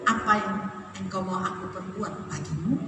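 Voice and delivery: soft, low, tender offer; hushed, caring question with a lifted ending; gentle question. Acted. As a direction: ask quietly as a kindly master. Jesus gently asks the blind man.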